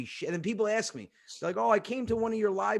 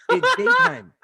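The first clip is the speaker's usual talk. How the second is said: high, loud mock disbelief; retold incredulous outburst; exasperated yell; 11 dB louder, slower than usual. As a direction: burst out in exasperation. Son exasperated by father's remark.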